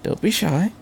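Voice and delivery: doctor softly coaxing the nervous pilot; whispered encouragement. A narrator's reading. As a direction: gently urge a shy boy on. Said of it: "the doctor whispered".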